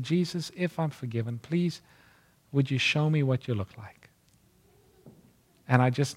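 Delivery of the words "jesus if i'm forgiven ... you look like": put-on hushed child's prayer. Child prays alone in the dark.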